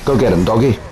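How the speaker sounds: usual speaking voice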